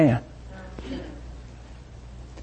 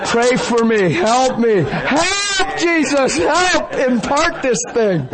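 high laughing cries for help; holy laughter; 10 semitones higher, much slower than usual. Real, not acted. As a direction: overcome with joyful laughter. Ecstatic laughing prayer.

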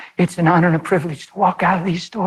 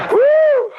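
loud high whoop; 5 dB louder than usual. Real, not acted. Preacher exclaims.

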